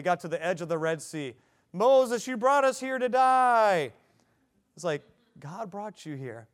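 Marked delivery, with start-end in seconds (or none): 1.7-3.9 high, loud, slow mock complaint; whining Israelites. Israelites complaining at Red Sea. 5.4-6.5 calm reply. Retold or imitated. Preacher's calm answer to complainers.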